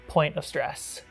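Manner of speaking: usual speaking voice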